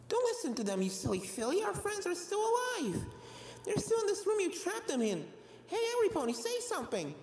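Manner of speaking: put-on high, soft, encouraging young voice; spunky filly. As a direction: cheer her up from the dark. A friend calls from darkness.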